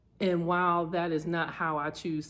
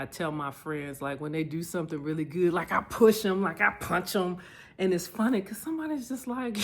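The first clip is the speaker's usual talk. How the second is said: ordinary talk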